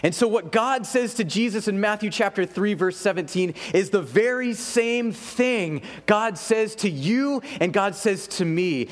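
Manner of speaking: ordinary talk, nothing marked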